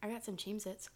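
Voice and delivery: soft unvoiced whisper; ASMR whisper. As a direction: whisper close to the mic.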